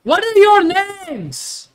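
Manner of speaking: loud, slow, high call upward; calling up loudly. Acted. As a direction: shout up to someone far above. Calling up to giant.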